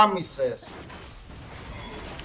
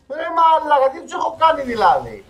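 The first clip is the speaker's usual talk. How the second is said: indignant whine; 9 semitones higher, 3 dB louder than usual. Real, not acted. Indignant complaint at team.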